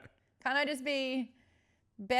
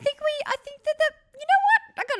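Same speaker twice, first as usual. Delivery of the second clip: sudden high burst of an idea; excited high squeal; 15 dB louder than usual. Real, not acted.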